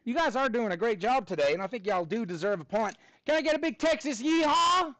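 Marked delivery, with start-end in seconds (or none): 3.2-4.9 high and loud, rallying the class; big Texas hype call. Real, not acted. Teacher rallying the class for a Texas yeehaw, excited high call.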